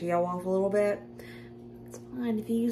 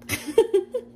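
high giggle; 13 semitones higher, 4 dB louder than usual. Real, not acted. Laughing at her own doubts.

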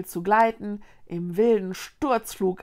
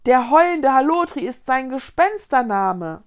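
loud, higher, urgent revelation; alarmed explanation at raised volume; sudden realisation; 4 semitones higher, 8 dB louder than usual. A narrator's reading. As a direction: blurt out what just dawned on you. Konrad's sudden realisation, blurted out.

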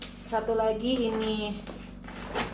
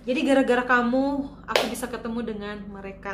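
ordinary talk

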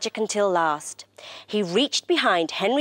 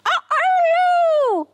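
very high imitated rooster call; loud cockerel crow; 6 dB louder than usual. A narrator's reading. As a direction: crow out the sunrise. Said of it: "the cockerel crowed loudly".

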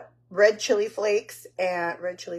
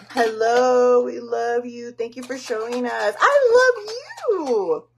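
gushing greeting; 3 semitones higher, 4 dB louder, slower than usual. Real, not acted.

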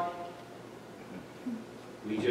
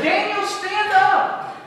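much higher, slow call from above; commanding angel; 13 semitones higher, 3 dB louder, slower than usual. Acted. Gabriel telling Daniel to rise, high and slow. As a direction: lift him up with an urgent summons. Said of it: "and he says".

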